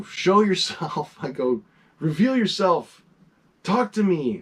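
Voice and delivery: hammed-up ghost hunter beckoning the spirit; calling the ghost out of the living room, half-mocking and theatrical.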